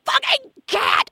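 high mock outburst at the cat, slow; angry yell. Angry at the cat peeing in the sand, high yell. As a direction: spit out the curse at the cat. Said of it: "I was like".

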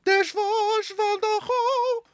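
slow, high, spooky narrator voice; haunted-house storyteller, drawn out; haunting voice. Retold or imitated. Retelling opera interpreter voice.

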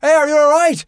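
high, loud call from behind; acted-out stranger calling out; imitating a bystander. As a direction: shout over to check on someone. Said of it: "this other guy from behind us goes".

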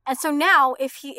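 ordinary talk, nothing marked

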